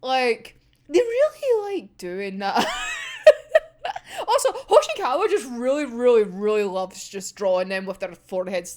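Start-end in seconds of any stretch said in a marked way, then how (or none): 2.7-3.6 squealing laugh, voice way up; delighted high-pitched squeal of laughter. Real, not acted. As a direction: break into a shrieking giggle. Laughs at fanart habit.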